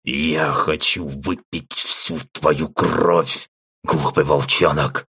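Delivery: slow, grating undead voice; dead voice. Acted. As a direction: grind it out like a corpse. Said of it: "мёртвым голосом проскрежетал".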